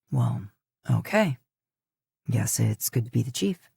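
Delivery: low, wry male voice; a touch of a smirking husband; Dean's voice. A narrator's reading. Dean smirks.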